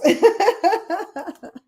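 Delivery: high cackling burst of laughter; delighted laugh. Real, not acted. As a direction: laugh out high after cheering. Laughing after her own cheer.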